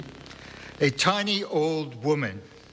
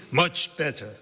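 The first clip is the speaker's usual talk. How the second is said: put-on old woman's Central European accent; lightly voiced old Central European woman; 2 semitones higher than usual. A narrator's reading. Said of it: "in a strong Central European accent".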